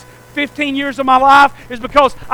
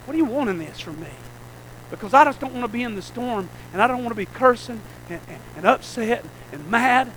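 hushed prayer; 3 semitones lower, 11 dB quieter than usual. Retold or imitated. Believer in the storm confessing softly to God, hushed. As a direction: drop to a soft, quick, intimate plea. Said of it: "find him and say".

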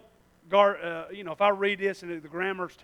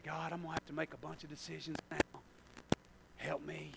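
desperate prayer; 5 semitones lower, 18 dB quieter than usual. Retold or imitated. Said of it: "and you say".

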